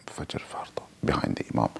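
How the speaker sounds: barely audible unvoiced whisper; whisper to self. Shown as a demonstration. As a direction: whisper it privately, just for your own ears. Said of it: "what I'm whispering to myself".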